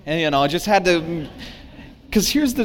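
ordinary delivery

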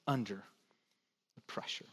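whispered ending, barely voiced. Real, not acted. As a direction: let it fall to a whisper. Sermon climax drops to whisper.

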